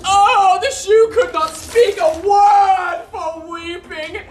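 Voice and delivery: comic blubbering through a laugh; hammed-up weeping. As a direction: wail it out as if the shoe cries. Launce acts out tearful farewell.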